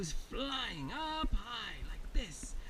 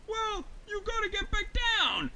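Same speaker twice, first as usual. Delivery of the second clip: high, raised bear voice; urgent calling up; 9 semitones higher, 4 dB louder than usual. Acted. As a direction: holler instructions to a friend overhead. Honey Bear shouts up instructions.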